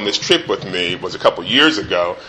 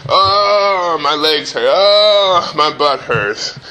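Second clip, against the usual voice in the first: higher mock whine, drawn-out oh's; lightly hammed-up whining child; whining kid; 5 semitones higher, much slower than usual.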